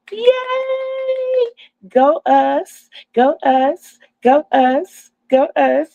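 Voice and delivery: rally the viewers, celebrating; cheering. Real, not acted.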